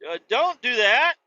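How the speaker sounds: high, loud warning shout; mock yell. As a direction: shout a quick warning at Iran. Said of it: "it's like".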